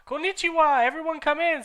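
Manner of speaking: very high cheery welcome; the teacher greeting in Japanese. Acted. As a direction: greet the class brightly. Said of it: "said Professor Sinister in Japanese".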